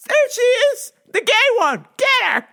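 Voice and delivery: high loud mock shout of the arresting cops; mock police shout. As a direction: yell like cops spotting a suspect. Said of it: "They're gonna go".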